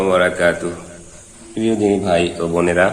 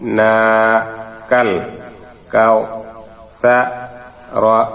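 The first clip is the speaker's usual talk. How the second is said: না, আ, কই said one by one with pauses; broken syllable drill; much slower than usual. Shown as a demonstration. Teacher breaks the sura into syllables for students to repeat. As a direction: spell it out syllable by syllable. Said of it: "আবার ভাঙ্গা ভাঙ্গা উচ্চারণ করি".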